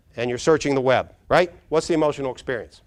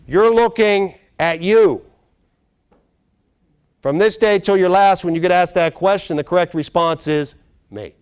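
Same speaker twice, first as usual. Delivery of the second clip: emphatic declaration; 6 semitones higher, 10 dB louder, slower than usual. Real, not acted. Emphatic revelation to the audience.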